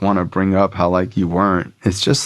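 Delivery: ordinary talk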